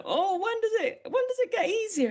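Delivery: very high, plaintive mock question; mock plea. Acts out plea to terrier owners.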